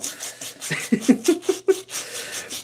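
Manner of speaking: breathless high-pitched giggle; wheezing giggle, no voice; high airy giggle. Real, not acted. Storyteller cracking up at his own story, breathless giggle.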